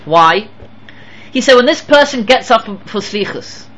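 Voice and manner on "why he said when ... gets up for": usual speaking voice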